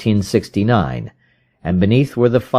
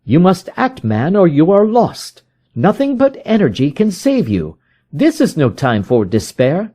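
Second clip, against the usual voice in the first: higher, pressing detective's voice; urgent Holmes; 10 semitones higher than usual. A narrator's reading. Holmes urges the frightened visitor, higher and pressing. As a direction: rouse a despairing man to action.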